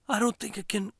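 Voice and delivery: high, breathy, failing voice; huffed. Acted. Dying wife telling her husband, huffed. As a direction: huff it out in pain. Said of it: "she huffed".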